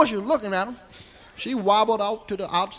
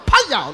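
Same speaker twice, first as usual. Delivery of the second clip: loud, punchy slap noise; imitated slap sound; 5 dB louder than usual. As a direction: smack the word out like a blow.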